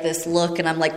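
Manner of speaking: ordinary talk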